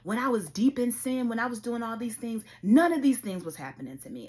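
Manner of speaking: high, heated complaint to herself in the car; angry rant. Retold or imitated. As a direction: vent loudly at the world, fed up. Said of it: "that's what I was saying".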